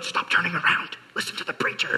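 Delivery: hushed whisper of a mother in church; whispered scold. Retold or imitated. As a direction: hiss it quietly from the pew.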